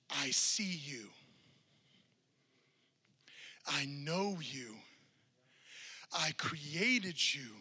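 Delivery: slow, gentle reassurance as if leaning close; tender whisper. Acted. Pastor voicing Jesus to the congregation, tender and slow. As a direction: start in a hushed whisper, then slow right down.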